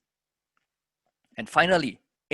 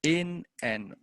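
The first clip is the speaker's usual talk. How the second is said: very slow metered chant, syllables held on the beat; poem in three; much slower than usual. Shown as a demonstration. Teacher trying to fit a meter to verse, slow measured recital. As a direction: count it out in three, stretching stressed syllables. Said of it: "maybe in three".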